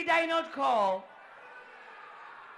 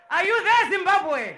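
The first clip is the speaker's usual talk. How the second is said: loud, high shout falling on the country name; calling out; 4 semitones higher, 7 dB louder than usual. Real, not acted. MC calls on Zimbabwe's team, shouting.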